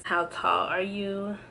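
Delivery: usual speaking voice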